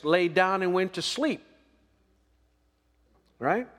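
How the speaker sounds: full pulpit delivery; preaching. Acted. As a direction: preach it out to the congregation. Preaching to the congregation.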